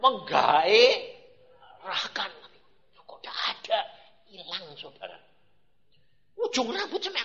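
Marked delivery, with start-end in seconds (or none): 0.0-2.3 stretched very slowly and savoured; drawn-out relish. Acted. Preacher recalling the poster, drawing it out slowly. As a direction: draw the word out slowly.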